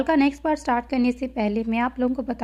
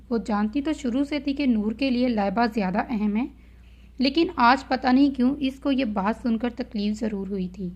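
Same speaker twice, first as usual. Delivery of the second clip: plain reading voice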